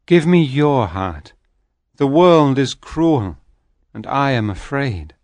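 plead, shrinking with fear; frightened begging soul. A narrator's reading. Soul begs, afraid.

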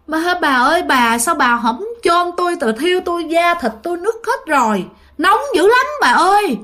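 high, rising wail of a ghost in pain; ghost's plea. Retold or imitated. Dead father returning home to beg his wife, high wailing. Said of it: "cứ về đi vô nhà cái nói".

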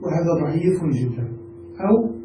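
ordinary talk

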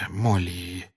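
soft, restrained calling of her name. Acted. Lupin going to the sobbing Molly, restrained and soft. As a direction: hold yourself back, gently. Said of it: "сдержанно сказал".

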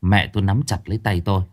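usual speaking voice

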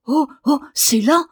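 high, loud gasps of wonder; breathless cry of discovery; excited gasping. A narrator's reading. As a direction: gasp it out, thrilled. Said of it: "haleta Daniel".

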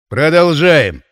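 loud, brisk call to order; announcing. A narrator's reading. Deputy voivode moves the meeting on, announcing. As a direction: declare it firmly to the room. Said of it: "объявил заместитель воеводы".

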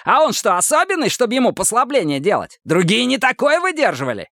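high-pitched indignant tirade; snarling protest. A narrator's reading. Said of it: "ощерился Мазур". (злое возмущение высоким голосом)

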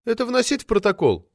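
high, plaintive woman's voice. A narrator's reading. Secretary asking whether to minute it, plaintive. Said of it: "жалобно спросила Арина".

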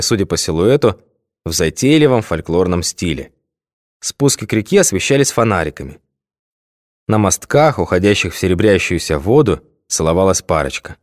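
plain reading voice

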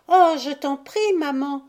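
put-on high, loud child begging; pleading cry. A narrator's reading. Violette begging her mother to look, pressing cry. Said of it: "cria Violette".

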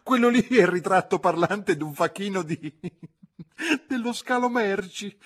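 put-on high, loud laughing old lady voice; unable to stop laughing. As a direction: shriek it through helpless giggles. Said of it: "Non riuscì a frenare l'ilarità". (ridendo a crepapelle, acuta e forte)

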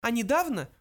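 high little mouse voice; high-pitched small creature. A narrator's reading. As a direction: state it as a tiny mouse. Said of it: "продолжал его коллега".

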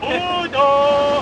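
high, loud call from a distance. Acted. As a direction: shout his name across the beach. Calling him across the beach. (laut und hoch aus der Ferne rufen)

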